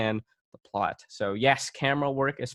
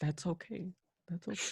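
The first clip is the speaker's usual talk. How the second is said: much softer, gentle reassurance; reassuring; 6 semitones higher, 14 dB quieter than usual. Real, not acted. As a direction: comfort a sorry friend. Soft comforting reassurance.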